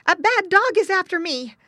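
high-pitched, alarmed piglet; frightened little pig. A narrator's reading. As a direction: squeal it out as a hunted piglet. Piglet fleeing a dog.

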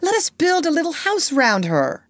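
high-pitched eager announcement; excited cry. A narrator's reading. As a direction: cry out a brilliant idea. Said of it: "cried Peter".

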